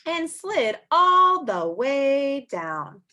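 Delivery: slow, loud, drawn-out slide. A narrator's reading. Reading to children, slow sliding words.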